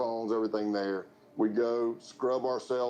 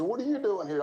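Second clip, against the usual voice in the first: much higher, playful fake astonishment; mock surprise; 10 semitones higher than usual. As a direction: play along with the staged reunion. Agent plays along, retold.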